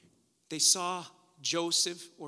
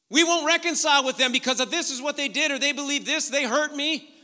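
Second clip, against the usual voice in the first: building to a raised, loud pitch; forceful preaching; 5 semitones higher, 8 dB louder than usual. Real, not acted. Preacher confronting a divided church family, forceful preaching. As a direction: press the challenge on the congregation.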